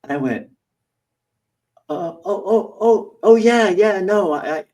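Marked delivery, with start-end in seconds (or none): 1.9-4.6 high, loud hammed-up oh yeah; mock fake recognition, pitched up; feigned recognition. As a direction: overplay suddenly remembering.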